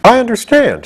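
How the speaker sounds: bright, high cheerful reply; a cheerful wooden man. A narrator's reading. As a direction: say it happily. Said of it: "said Jack cheerfully".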